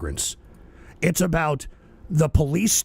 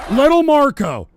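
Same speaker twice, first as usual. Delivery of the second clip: mocking Trump; 7 semitones higher, 8 dB louder than usual. Mocking Trump's nickname for Rubio.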